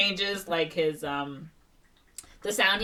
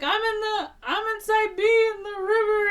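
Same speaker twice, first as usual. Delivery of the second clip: high, raised-voice phone yell; imitated high-pitched panicked shouting; mock frantic call; 6 dB louder than usual. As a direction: call out over a bad line.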